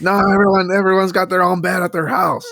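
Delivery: mock mom laying down the law; imitating mom. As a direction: lay down the rule like a strict mother. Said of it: "And she's like".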